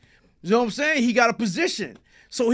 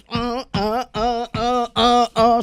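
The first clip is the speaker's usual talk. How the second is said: short, repeated effort grunts; imitated stomping grunts; 3 semitones higher, 5 dB louder, much slower than usual. Storyteller acting out stomping a man, imitated grunts. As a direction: grunt rhythmically with each stomp.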